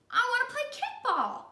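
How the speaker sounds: high, loud whine; high whiny child voice; whiny Ron. Acted. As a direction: whine it like a little kid. Ron asking Louis.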